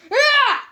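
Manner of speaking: high loud startled cry; startled yell. Acted. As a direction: jump in fright. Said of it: "Sans and Frisk yelled".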